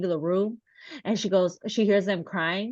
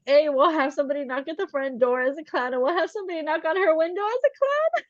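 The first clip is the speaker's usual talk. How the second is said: eager, higher-pitched run-on pitch; retold prank pitch; 8 semitones higher, faster than usual. Friends pitching a clown prank at the window, eagerly. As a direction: rattle off the scheme, rising at the end.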